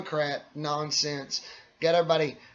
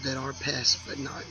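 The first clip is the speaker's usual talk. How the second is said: hushed, grave whispering throughout; somber whisper; 3 dB quieter than usual. Acted. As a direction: whisper it like a solemn warning. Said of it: "This is a somber public service announcement".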